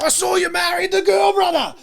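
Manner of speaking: high, loud mock shout; Birdman shouting. Wrestler greets him years later, high loud shout. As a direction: bellow it like a hyped-up wrestler greeting an old friend. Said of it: "he's like".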